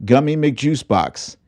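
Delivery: usual speaking voice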